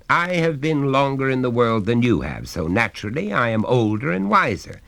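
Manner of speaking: stern father. Acted. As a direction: lay down the law to the boy.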